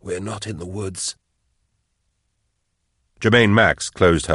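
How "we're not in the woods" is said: soft, hushed, dread-filled words; whispered. A narrator's reading. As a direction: breathe it out quietly. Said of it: "he whispered".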